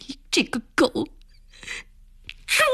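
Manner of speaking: cursing through a mild mock sob; sobbing curse. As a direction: spit out 你这个狗，猪 while half-crying. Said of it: "暖暖呜咽着， 骂着". (带着呜咽骂出你这个狗，猪)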